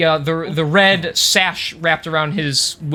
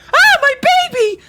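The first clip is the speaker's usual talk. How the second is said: much higher panicked cry far off; distant woman's cry; 5 dB louder than usual. Acted. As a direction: cry out in high panic from afar.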